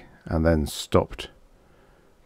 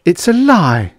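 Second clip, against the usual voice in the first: high, loud heated insistence; persistent denial; 11 semitones higher, 7 dB louder than usual. A narrator's reading. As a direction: insist on it, pitched high and forceful. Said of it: "she persisted".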